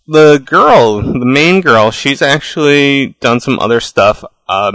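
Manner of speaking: normal talking voice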